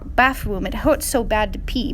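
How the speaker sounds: ordinary delivery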